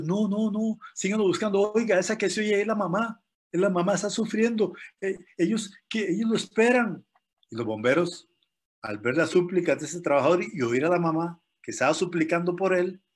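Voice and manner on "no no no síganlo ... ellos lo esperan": high, urgent begging to the firemen; pleading coworker. Retold or imitated. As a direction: beg them not to stop, pitched up. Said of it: "diciendo a los bomberos".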